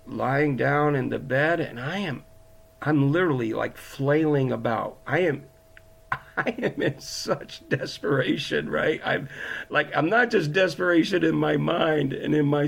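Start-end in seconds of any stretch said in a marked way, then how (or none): none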